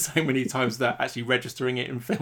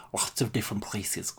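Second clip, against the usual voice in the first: put-on Lambert's odd accent, lower and softer from the back of the throat; thick-tongued Christopher Lambert accent; 3 semitones lower, 6 dB quieter than usual. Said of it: "with a very thick tongue and kind of from the back of the throat".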